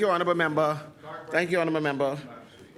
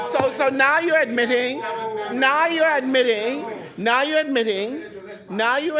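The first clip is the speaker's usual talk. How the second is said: loud, higher, pressing the point; triumphant raised voice; 5 semitones higher, 4 dB louder than usual. Real, not acted. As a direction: pounce on the admission. Speaker seizing on an admission.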